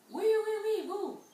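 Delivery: teacher playfully calls on the class; playful exclaim. Real, not acted. Teacher teasing children.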